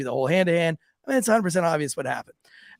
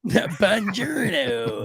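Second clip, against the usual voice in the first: slow hammed-up Italian greeting; mock Italian; 4 semitones higher, much slower than usual. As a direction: stretch it like a cartoon Italian.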